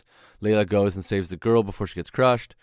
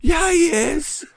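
much higher, loud whooping cheer; 8 dB louder, much slower than usual. Acted. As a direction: cheer like a fan at a hockey game. Cheering for Wolverine quote.

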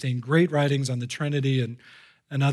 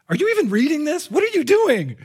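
high, quick, exasperated disbelief; high-pitched mock outrage; incredulous outburst; 12 semitones higher, 6 dB louder than usual. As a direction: burst out as if appalled by them. Acting his exasperation at allegorizers.

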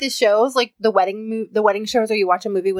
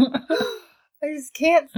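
very slow, higher, drawn-out lament; can't help it; 6 semitones higher, much slower than usual. Retold or imitated. Mother explaining her crying, slow mock lament. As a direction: stretch it like you're helpless. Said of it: "it's terrible".

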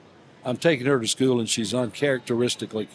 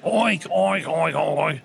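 silly oinking; 3 semitones higher, much slower than usual. Retold or imitated. Grandpa's silly cow joke.

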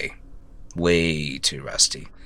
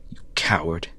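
a harsh whisper, barely voiced; whispered insult; 3 semitones lower, 8 dB quieter than usual. At Simon's grave, cursing the dead brother in a whisper. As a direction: spit it out under your breath.

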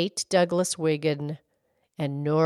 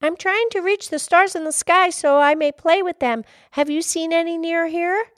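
high, louder lass asking eagerly; high-pitched little-girl question; 13 semitones higher, 6 dB louder than usual. Acted. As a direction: plead politely in a high child's voice. Little girl voiced in story.

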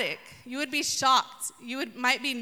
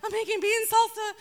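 busy squeal; 8 semitones higher than usual. Pretend. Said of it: "Martha's over there like".

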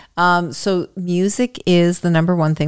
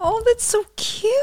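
cooing; 3 dB quieter than usual. Real, not acted. Mother cooing at her daughter.

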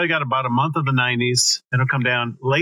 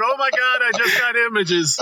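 laughing; 8 semitones higher than usual. Retold or imitated. Repeats co-host's line, laughing.

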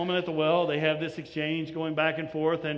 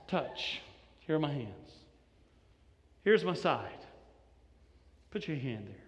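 tender invitation; 4 semitones lower, 4 dB quieter than usual. Acted. Jesus inviting Thomas.

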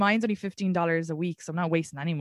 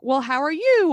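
mock polite greeting; 8 semitones higher, 9 dB louder than usual. Mock polite small talk.

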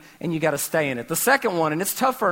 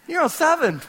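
mock dismay at neighbour; 8 semitones higher than usual. Acts out peeking at neighbour's treadmill.

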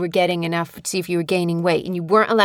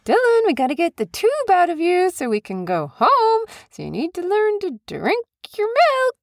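put-on high sing-song baby talk; pleading mother; 12 semitones higher than usual. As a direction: coax a baby in a high, swooping voice. Mother pleading with newborn to feed.

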